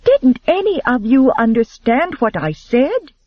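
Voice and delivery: high, pleading question; imploring. A narrator's reading. Elizabeth pleading with the watching men, high and imploring. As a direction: beg them to listen. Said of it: "she implored".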